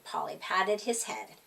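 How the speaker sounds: usual speaking voice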